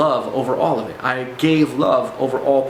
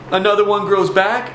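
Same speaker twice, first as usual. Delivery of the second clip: higher, anxious mock answer; raised-pitch nervous imitation; imitated shaking fear; 6 semitones higher, 3 dB louder than usual. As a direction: act out a scared man answering. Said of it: "he's, like, totally shaking at this time".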